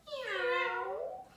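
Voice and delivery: a high, rising playful meow; cat meow. Pretend. Teacher meowing for the cat pose, high and rising.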